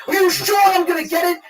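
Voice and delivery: high, frantic pleading; worked-up, high-pitched worry; retold panic. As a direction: beg for reassurance, near breakdown. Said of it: "I had a nervous breakdown".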